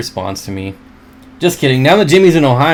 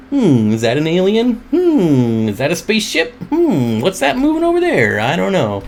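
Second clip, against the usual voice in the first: higher, musing hmms as if spotting aliens; mock wondering stargazer; 5 semitones higher than usual. Host on his deck imagining aliens, mock wonder. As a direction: playfully puzzle over the moon's surface.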